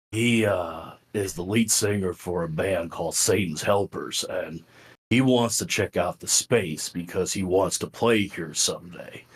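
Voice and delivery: low, deep voice imitating a buddy; deep-voiced friend. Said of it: "Mike has a deep voice".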